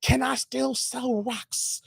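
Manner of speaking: very slow, stretched-out mock question; comedy sketch quote. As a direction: drag every word out theatrically. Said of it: "I was like".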